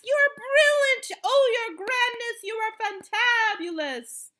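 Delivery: high, loud adoring gush; cried out. A narrator's reading. Witches applauding their leader, cried out. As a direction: gush praise excitedly. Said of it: "cried the witches".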